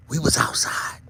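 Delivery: whispered, breathy hush. Acted. Vlogger hushing the point, whisper. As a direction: let it drop to a bare whisper. Whispered then shouted repeat.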